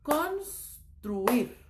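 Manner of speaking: "construir" as cons... truir; two separated pieces of "construir": cons, truir; correct syllable split. Shown as a demonstration. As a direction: break "construir" after the s, tr starting the next syllable. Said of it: "va a ser".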